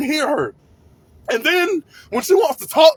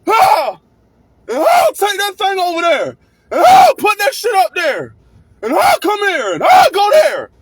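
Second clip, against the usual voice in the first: high, loud bossy yelling; mock loud coworker; 7 semitones higher, 5 dB louder than usual. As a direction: shout orders like a bossy coworker. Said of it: "It's always".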